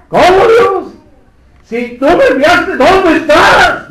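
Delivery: high, loud, slow cry to God; anguished plea. Acted. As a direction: stretch out the last question in despair. Preacher voices Christ's anguished plea.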